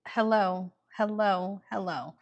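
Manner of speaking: ordinary talk, nothing marked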